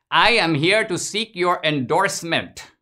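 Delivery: mock Indian accent, high and loud; a polite immigrant pitching himself, hammed-up high voice; Mister Gadde. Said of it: "imaginative rendition".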